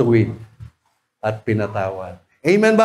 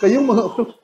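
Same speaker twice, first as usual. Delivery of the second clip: tearful rebuke; 3 semitones higher, much faster than usual. Retold or imitated. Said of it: "Ganun lang".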